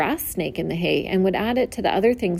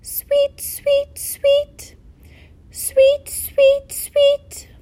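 very high sparrow song; bird song; 4 dB louder, much slower than usual. A narrator's reading. Narrator voicing the song sparrow, very high chirping song.

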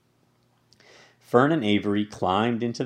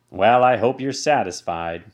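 sneer; 2 semitones higher, 8 dB louder than usual. Acted. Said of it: "sneered the rat".

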